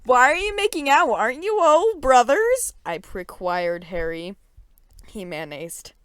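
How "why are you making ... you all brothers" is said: higher, puzzled question; confused girl. A narrator's reading. Girl asking the boys why they kiss, high baffled question.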